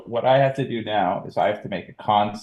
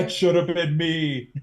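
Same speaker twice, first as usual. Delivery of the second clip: high-pitched mock lament; heartbroken; 7 semitones higher than usual. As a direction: play it hammed-up heartbroken. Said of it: "he is heartbroken".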